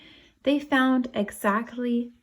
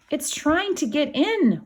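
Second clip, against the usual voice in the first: higher, louder frightened cry; scared yell; 8 semitones higher, 4 dB louder than usual. Acted. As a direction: yell it like a panicked boy. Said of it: "one boy yelled".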